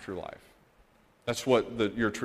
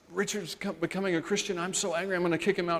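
angry father; 9 semitones higher, faster than usual. Retold or imitated. Angry father voiced in retelling.

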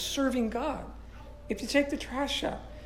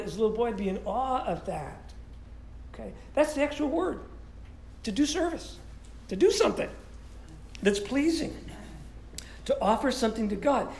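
ordinary delivery

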